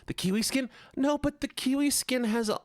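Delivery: very high, protesting whine; put-on whiny complaint. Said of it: "it's all whiny".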